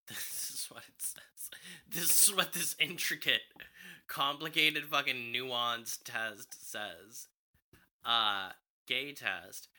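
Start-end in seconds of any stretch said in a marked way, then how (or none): 0.1-1.5 breathy laughing whisper. Real, not acted. 1.9-7.3 mock indignant. Real, not acted. Mock outrage at the test.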